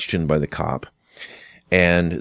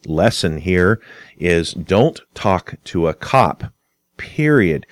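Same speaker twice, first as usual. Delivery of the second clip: ordinary talk, nothing marked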